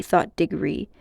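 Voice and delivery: ordinary delivery, nothing marked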